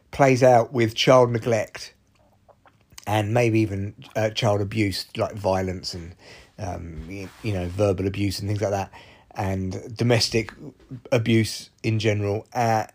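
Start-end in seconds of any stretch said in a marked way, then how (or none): none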